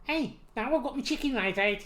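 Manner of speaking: Scouse accent. Shown as a demonstration. Asked for Scouse, talks about chicken, Scouse accent. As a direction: put on a Liverpool accent.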